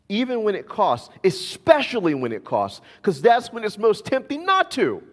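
high, loud climax; preaching. Acted. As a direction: lift it to a peak. Emphatic sermon climax.

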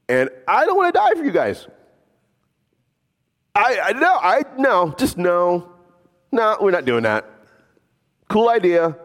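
high, whiny, fed-up voice; exasperated refusal. Pretend. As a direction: play it like someone throwing up his hands, pitched high. Imagined Jesus refusing the cross.

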